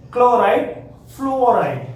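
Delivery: "Chloride" and "fluoride" both said high and loud; contrasting pair. Shown as a demonstration. Teacher contrasts chloride and fluoride, emphatic. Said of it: "this is not fluoride".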